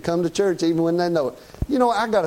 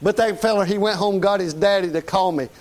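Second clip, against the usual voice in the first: ordinary delivery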